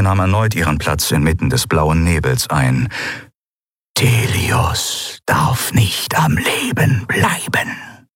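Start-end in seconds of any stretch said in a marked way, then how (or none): 3.9-8.1 slow, eerie whisper; governor's mask hissing a cold order in whisper. Acted. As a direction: breathe the death sentence. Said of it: "flüsterte Sylravan".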